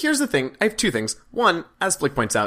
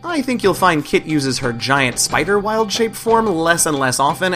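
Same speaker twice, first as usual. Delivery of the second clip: narration; 2 semitones higher, 5 dB louder than usual. A narrator's reading. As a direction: lively recap narration. Game master narration recap.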